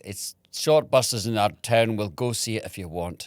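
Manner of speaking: Scottish mom. Retold or imitated. Mom offers to see son's film, Scottish accent. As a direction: put on Mom's Scottish accent. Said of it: "she's Scottish".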